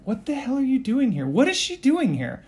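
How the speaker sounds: high-pitched outraged questioning; acted, exasperated outburst; indignant demand. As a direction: demand to know, voice jumping up. Character demands an explanation of her presence.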